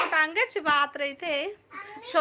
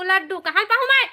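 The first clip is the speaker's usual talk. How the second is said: loud question climbing in pitch; real exasperation; 4 semitones higher, 7 dB louder, faster than usual. Real, not acted. Parent to child demanding laddu, exasperated.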